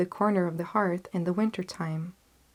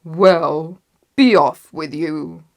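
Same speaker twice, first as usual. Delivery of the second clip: loud troll dismissal; gruff troll; 10 dB louder, slower than usual. A narrator's reading. Troll letting the little goat go, loud and gruff. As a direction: send them away in a booming troll voice. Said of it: "said the troll".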